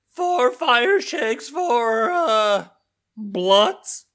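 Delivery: put-on high, slow, nasal-free teen calling out names; high-pitched teen at the counter; young teen voice. A narrator's reading. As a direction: call out the order like a bored teen clerk. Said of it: "called the voice of a young teen".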